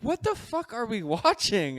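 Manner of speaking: loud, high, drawn-out mock disbelief; retold outrage. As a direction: imitate an appalled partner crying out. Retelling Chelsea's reaction to the show.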